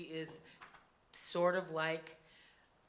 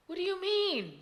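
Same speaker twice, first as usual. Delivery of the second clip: put-on high, louder child's question; startled child; 9 dB louder than usual. A narrator's reading. Child answering the girl's alarm, high startled voice.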